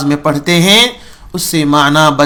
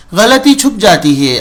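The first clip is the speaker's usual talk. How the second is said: ordinary delivery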